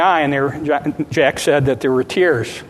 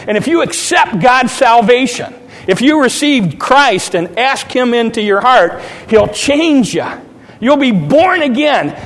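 high, insistent salvation appeal; fervent preaching; 5 semitones higher than usual. Retold or imitated. Son urging his mother to accept salvation, fervent preaching. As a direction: preach it with rising force and punched key words.

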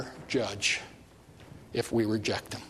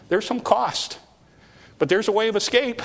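preaching; 9 semitones higher, 4 dB louder than usual. Real, not acted. Preacher rising to climax.

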